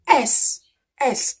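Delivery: "S" said as "ess", vowel first; letter name demo. Shown as a demonstration. Teacher showing the abbreviation's sound, "S" as an isolated "ess". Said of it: "we pronounce it".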